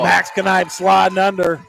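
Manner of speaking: loud, punched-out call; excited play-by-play. Real, not acted. Announcer hails Max Knipe drawing a charge, excited play-by-play. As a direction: shout the defensive play.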